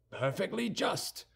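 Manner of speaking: high, drawn-out cry of approval; exclaiming. A narrator's reading. Guardsmen approving a companion's verdict, exclaiming. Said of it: "cried the other two guardsmen".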